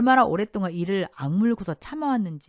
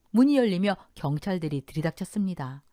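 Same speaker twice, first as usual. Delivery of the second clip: ordinary delivery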